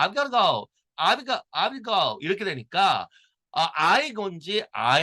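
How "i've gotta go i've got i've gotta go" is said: "I've gotta go" blurred together, higher-pitched; quick linked pronunciation. Shown as a demonstration. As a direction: run "gotta" into the next word, American style. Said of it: "이걸 빨리 발음하면".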